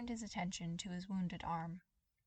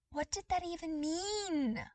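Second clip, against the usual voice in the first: baffled; 10 semitones higher, 5 dB louder than usual. Acted. Baffled inner thought.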